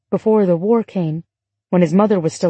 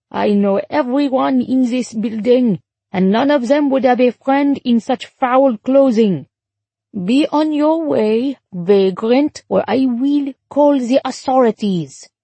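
put-on higher-pitched old woman with French accent; scolding French concierge; 5 semitones higher than usual. A narrator's reading. Concierge sending a vagrant away, scolding French accent.

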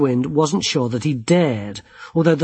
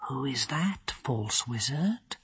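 soft, slow hushed question; hushed dryad; 13 dB quieter, slower than usual. A narrator's reading. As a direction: murmur it with cool menace. Druelai voiced quietly.

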